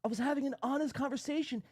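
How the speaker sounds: high mocking read; mocking John. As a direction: read his message like a whiny rival. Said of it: "then John comes back and says".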